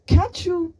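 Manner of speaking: high, loud, broken-off outburst; angry shout. A narrator's reading. Boy berating his friend, stopping himself, shouting. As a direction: start yelling, then stop short.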